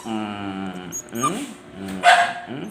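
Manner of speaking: ordinary delivery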